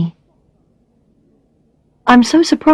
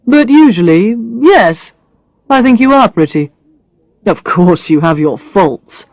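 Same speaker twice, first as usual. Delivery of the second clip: lightly voiced man, matter-of-fact teasing; a hint of a blunt man listing faults; Brad's voice; 3 semitones lower than usual. Brad listing Alex's faults, lightly voiced teasing man.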